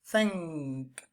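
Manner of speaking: "thank" softly with a nasal ng before the k. Shown as a demonstration. Teacher modelling "thank" for students. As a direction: say "thank" letting the ng ring in the nose. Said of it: "For example, the word thank".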